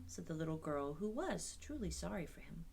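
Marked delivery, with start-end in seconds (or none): none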